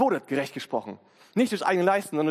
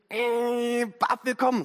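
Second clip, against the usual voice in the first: dopey tree voice; 5 semitones higher, much slower than usual. Pretend. A tree thinking aloud.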